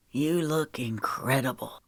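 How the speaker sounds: low, soft murmur. A narrator's reading. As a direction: murmur it close and tender. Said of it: "he murmured".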